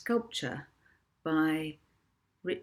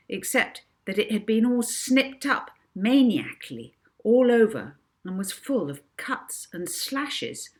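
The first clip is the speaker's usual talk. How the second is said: usual speaking voice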